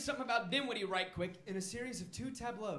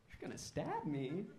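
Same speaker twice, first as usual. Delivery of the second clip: mock scared; 4 semitones lower, 9 dB quieter than usual. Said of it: "Now I'm scared".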